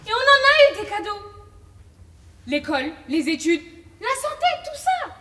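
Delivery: high, loud argument; heated insistence. Acted. Scolding Alexia about the gifts they had, heated insistence. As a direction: press each example home loudly. Acted quarrel, listing the gifts.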